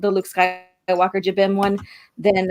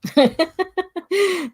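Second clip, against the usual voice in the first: high giggle; 9 semitones higher than usual. Real, not acted. Laughing at Cockney attempt.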